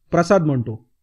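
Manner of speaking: ordinary delivery, nothing marked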